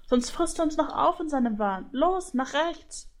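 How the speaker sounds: high, bickering goose chatter; squabbling goose. A narrator's reading. Goose panics as the griffin follows, squabbling. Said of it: "Das zänkische Geschnatter".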